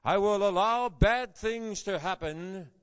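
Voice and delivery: loud, emphatic false version of God's words; mock misquote. Shown as a demonstration. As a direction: belt out the wrong quote to stress it. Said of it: "he doesn't quote God as saying".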